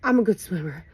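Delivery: ordinary talk, nothing marked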